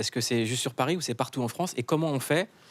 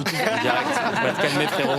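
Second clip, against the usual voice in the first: laughing tease; 8 semitones higher, 4 dB louder than usual. Real, not acted. Host teases guest, laughing.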